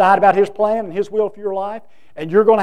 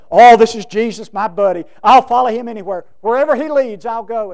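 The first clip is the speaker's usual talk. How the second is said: mock gung-ho eagerness, louder and higher in bursts; gung-ho eager; 3 semitones higher, 9 dB louder than usual. Preacher imitating the eager man who promised Jesus, mock excitement. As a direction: play the eager would-be follower, lightly hammed. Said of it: "at first he was all gung-ho".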